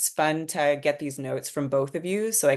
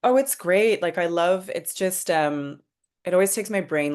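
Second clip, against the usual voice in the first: higher and louder, bubbling with delight; excited; 5 semitones higher, 4 dB louder than usual. Real, not acted. Writer answering the host's question, excited and pleased. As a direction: gush a little, thrilled by the praise. Writer thanked for her script.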